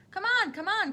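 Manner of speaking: high, loud, hurrying call; eager cry. A narrator's reading. Wonka hurrying the children along, loud eager cry. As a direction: urge them on, loud and high. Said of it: "cried Mister Wonka".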